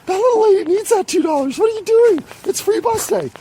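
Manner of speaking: very high, squeaky, scandalized voice; hammed-up high indignation; indignant outrage. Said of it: "this is when I'm like".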